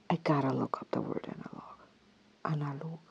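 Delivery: unvoiced whisper while searching; whispered to herself. Real, not acted. She whispers to herself while looking up a word, unvoiced. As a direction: whisper it as if thinking aloud.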